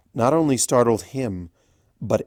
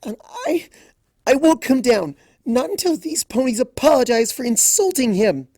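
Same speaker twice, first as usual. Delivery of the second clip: high loud stubborn refusal; hurt and stubborn; defiant; 13 semitones higher, 6 dB louder than usual. A narrator's reading. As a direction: dig in and refuse. Angry refusal in a standoff.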